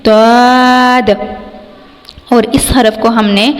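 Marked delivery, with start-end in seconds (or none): 0.1-1.1 ضاد (written داد) from the tongue's side against the upper molars, held long; heavy letter demo. Shown as a demonstration. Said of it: "اوپر والی داڑھوں کی جڑ سے". (داد زبان کی کروٹ سے)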